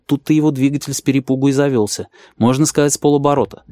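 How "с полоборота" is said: plain reading voice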